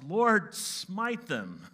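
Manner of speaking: loud, high cry to heaven; acted outburst, hardest on the first word; mock vengeful prayer. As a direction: call down wrath, hammed up. Acts prayer Jesus did not say.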